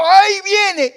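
long high scream; screaming mother in labor. Retold or imitated. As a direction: yell as the baby comes. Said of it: "Ella gritó".

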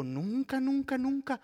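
high, loud repeated whine; hammed-up frustrated spouse; mock complaint. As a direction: mimic the spouse's exasperation. Pastor mimics complaining spouse.

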